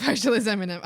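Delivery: amused, laughing at yourself. Real, not acted. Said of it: "Oh, I see".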